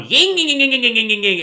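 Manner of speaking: high, loud revving engine whine; engine revving noise. Retold or imitated. Imitating a car engine for the podcast, loud high revs. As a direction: rev it like a tuned import. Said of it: "revving it up his cone".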